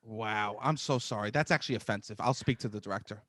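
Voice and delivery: low, hushed deadpan; quietly grave, pretend indignation; mock-solemn apology. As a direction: speak soft and low, dead serious.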